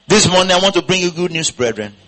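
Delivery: ordinary delivery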